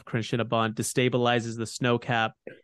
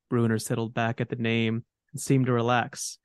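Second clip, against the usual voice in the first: ordinary delivery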